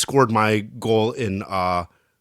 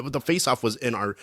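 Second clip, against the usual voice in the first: usual speaking voice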